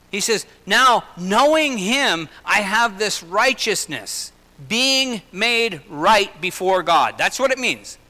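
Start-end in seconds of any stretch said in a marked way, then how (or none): 0.6-7.2 slow emphatic preaching. Acted. Preaching the key verse.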